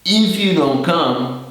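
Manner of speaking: "If you don't come" slow and high. Shown as a demonstration. Teacher marking the condition for learners. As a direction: lift the clause up slowly. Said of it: "is the condition".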